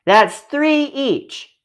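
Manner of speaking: put-on high, slow child voice counting it out; childlike, slowly sharing out; slow careful counting. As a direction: spell out the sum, drawn out. Children dividing cookies slowly.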